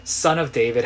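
moderately acted shout. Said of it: "He shouted all the more".

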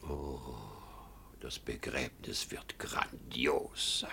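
picture the funeral in awe; dreamy whisper. Acted. Father dreams aloud of funeral. (verträumt geflüstert)

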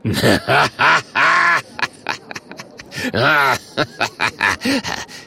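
big villain laugh in two bursts; helpless mocking laughter. The Murk laughing at Oskar, long uproarious guffaw. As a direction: laugh even harder, catch breath, go on. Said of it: "muss der Murk noch mehr lachen".

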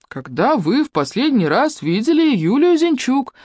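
slow, calm, deliberate repeat. A narrator's reading. Юнец calmly repeats his question, slow and even. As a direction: repeat it patiently. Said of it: "спокойно повторил юнец".

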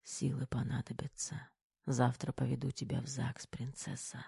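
very soft, whispery murmur; fading, barely voiced drowsy words; sleepy mumble. A narrator's reading. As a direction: mumble it while falling asleep. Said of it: "именно это пробормотал Кир, прежде чем уснул".

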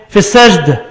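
usual speaking voice

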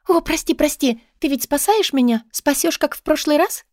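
put-on high, agitated young woman begging; high-pitched urgent entreaty; excited pleading. A narrator's reading. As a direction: plead in a breathless, hopeful rush. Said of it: "зашептала она возбуждённо".

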